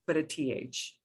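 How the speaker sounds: usual speaking voice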